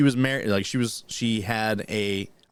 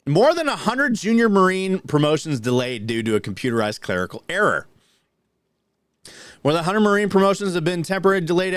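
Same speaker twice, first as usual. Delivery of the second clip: brisk, projected article read, pitched up; news read; 6 semitones higher than usual. Real, not acted. Host reading Marine promotion news to co-host, news read. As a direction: read the headline and story aloud like a news brief.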